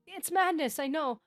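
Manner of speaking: high, pleading apology; pathetic apology. A narrator's reading. Rejected suitor apologizes through the door, high pleading. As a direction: beg forgiveness through the door. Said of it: "I offered my pathetic apologies".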